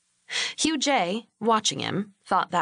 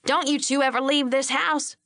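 aggressive; 6 semitones higher than usual. A narrator's reading. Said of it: "it came out sounding aggressive".

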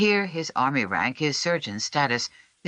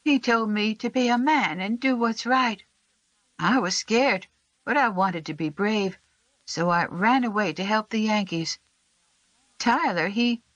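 put-on high child's voice, growing weaker; a brave scared boy telling the truth; little boy's voice, earnest then fading; Sam; 10 semitones higher than usual. A narrator's reading.

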